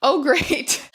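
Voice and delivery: ordinary talk, nothing marked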